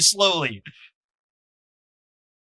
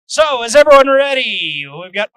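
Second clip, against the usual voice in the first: ordinary talk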